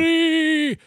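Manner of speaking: high, sung-out "free"; hammed-up cry of liberation; triumphant cry. Real, not acted. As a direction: hold the word high and long. Triumphant drawn-out word in recap.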